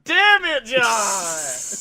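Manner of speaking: loud, high yell falling away; mock exasperation. Beaten player to John kicking out at two, mock exasperation. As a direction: howl it in hammed-up frustration. Acts losing opponent yelling at John.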